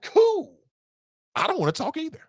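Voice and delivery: very high-pitched, gleeful agreement; delighted parent. Acted. Parent to kid who won't talk, gleefully high. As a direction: agree eagerly in a squeaky high voice.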